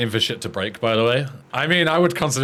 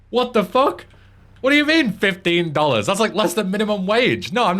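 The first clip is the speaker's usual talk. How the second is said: high, incredulous outrage; outraged; 10 semitones higher, 3 dB louder than usual. Real, not acted. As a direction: blow up in disbelief. Outraged at sponsor pay cut.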